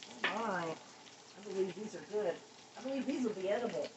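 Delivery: ordinary talk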